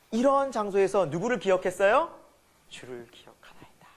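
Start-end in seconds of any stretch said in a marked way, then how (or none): 2.7-3.9 very soft, low whisper; hushed reverence. Acted. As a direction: drop right down to a whisper for the scripture answer. Hushed answer to his own question. (아주 낮게 속삭이듯)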